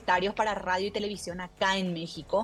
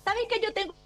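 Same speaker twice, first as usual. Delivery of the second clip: lightly voiced chatty driver, higher; eager taxi driver; 5 semitones higher than usual. Retelling a taxi driver jumping into her phone call, lightly voiced eager driver. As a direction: butt in like a helpful driver who overheard the call.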